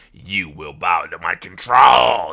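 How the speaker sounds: low menacing shouted threat; crazy villain. Retold or imitated. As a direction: boom it like a mad god. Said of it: "This is a crazy".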